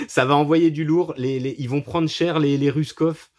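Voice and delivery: gleefully laughing about the carnage; amused laughing delight; laughing glee. Real, not acted. Gleeful about final shootout.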